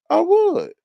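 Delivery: a high, emphatic I would; squeaky high I would. Real, not acted. To chat, squeaking I would high.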